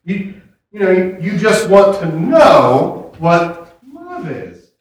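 ordinary talk

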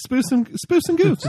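high, loud silly repeat; goofy high voice. Acted. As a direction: squeak it out like a cartoon. Repeats the catchphrase in a put-on voice.

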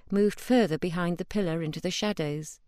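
ordinary delivery

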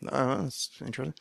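softly, to yourself, Oh drawn up at the end; a muttered private aside; quiet wry thought. Retold or imitated. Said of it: "And I thought".

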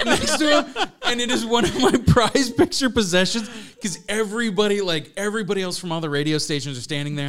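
ordinary talk, nothing marked

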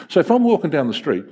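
normal talking voice

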